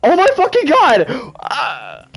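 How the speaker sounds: high, drawn-out cry of disbelief; slow, high-pitched shock; real astonishment. Real, not acted. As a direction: stretch each word out, high and stunned. Finds out he won championship.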